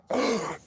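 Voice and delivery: sharp, high gasp of shock. Retold or imitated. Employees reacting to hot sauce with a quiet mock gasp. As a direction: suck in air like you just saw a fire. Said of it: "all the employees were like".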